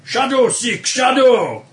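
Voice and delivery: a hint of a yelling guard; guard's challenge. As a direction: call out a challenge. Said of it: "One of the guards yells out a challenging phrase".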